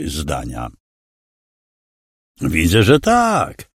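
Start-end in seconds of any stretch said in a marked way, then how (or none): 2.4-3.7 sneering villain voice, pitched up; smug threatening villain. A narrator's reading. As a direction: lean over him, mocking and menacing. Villain gloating.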